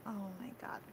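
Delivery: breathless whispered disbelief; exasperated whisper. Real, not acted. Player's exasperated aside to table.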